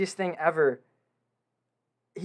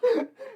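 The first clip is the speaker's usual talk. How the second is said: giddy laugh. Real, not acted. Overwhelmed laughter.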